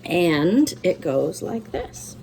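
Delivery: usual speaking voice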